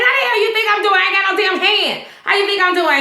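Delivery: patient with no hand snapping at his visitor, indignant; angry retort. Retold or imitated. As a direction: throw it back at him, offended. Ben snaps at Pete.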